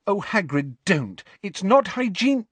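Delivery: put-on high, protesting girl voice; object, scandalised; Hermione protesting. A narrator's reading.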